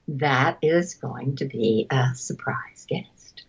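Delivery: secretive whisper; conspiratorial whisper. Retold or imitated. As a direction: hush it like a secret. Secret surprise guest.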